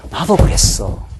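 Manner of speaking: soft unvoiced whisper of confession; whispered admission. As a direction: say it under your breath, owning up. Preacher voices his inner admission.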